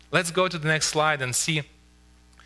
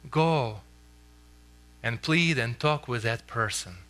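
gentle, tender. Shown as a demonstration. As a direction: speak it like a plea full of love. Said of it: "I think he would say something like this".

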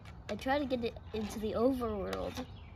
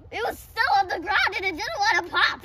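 excited; 7 semitones higher, 9 dB louder than usual. Real, not acted. Loud excited report.

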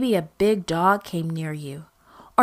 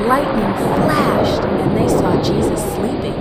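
loud dramatic narration; 6 dB louder than usual. A narrator's reading. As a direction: boom it out like thunder. Storm climax narration.

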